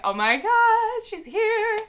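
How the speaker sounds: very high, slow, breathless awe; starstruck awe. Retold or imitated. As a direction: stretch it out in starstruck disbelief. Starstruck fans meeting idol.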